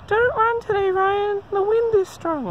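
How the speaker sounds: very high, nagging whine; whiny little voice. Retold or imitated. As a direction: whine like a pesky inner voice. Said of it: "that whiny little voice".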